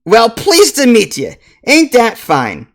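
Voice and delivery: loud, high dwarf brogue; hearty dwarf baker pleased by an introduction; hammed-up accent, pushed high and loud; Grisbeck Hearthstone. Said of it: "and he bobs his head".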